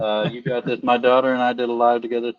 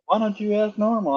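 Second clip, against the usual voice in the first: higher-pitched imitation of a mother; mom's scolding question; 10 semitones higher than usual. Mom asking her kid to act normal, high-pitched imitation. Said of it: "My mom always said".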